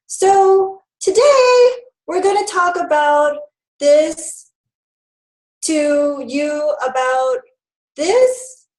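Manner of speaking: exaggerated upspeak, "education" and "this" lifted like questions. Shown as a demonstration. Presenter demonstrating a bad speaking habit to attendees, exaggerated upspeak. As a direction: let "presentation", "today" and "this" climb high, drawn out, as if asking. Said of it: "your voice goes up and it keeps inflecting upward".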